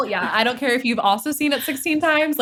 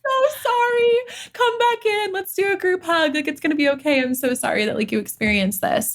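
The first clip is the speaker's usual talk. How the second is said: put-on hug a hurt child self; tender apology; 10 semitones higher than usual. Comforting past self, acted.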